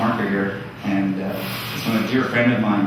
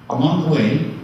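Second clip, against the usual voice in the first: normal talking voice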